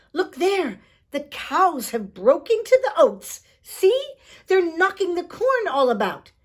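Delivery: high, loud snappish little man; cranky fairy cobbler telling Tom off; sharp leprechaun. Acted. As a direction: scold the pestering boy from up high. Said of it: "answered Tom sharply".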